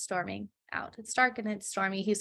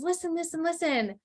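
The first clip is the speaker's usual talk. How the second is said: pleading father; 6 semitones higher than usual. Retold or imitated. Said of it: "the man says".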